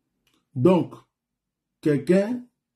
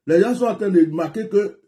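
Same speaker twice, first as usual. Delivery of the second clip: normal talking voice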